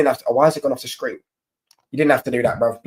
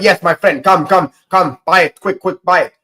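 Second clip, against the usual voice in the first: put-on pushy Greek market seller accent, higher and louder; urgent sales patter in a mock Greek accent; 5 semitones higher, 6 dB louder than usual. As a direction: imitate a Greek hawker's accent hurrying a customer. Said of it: "didn't have to do the accent".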